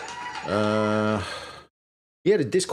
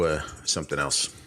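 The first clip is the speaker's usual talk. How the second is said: ordinary talk, nothing marked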